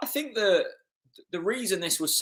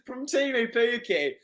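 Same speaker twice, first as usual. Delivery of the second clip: delighted; 10 semitones higher, 7 dB louder, slower than usual. Real, not acted. Fan raves about the assist.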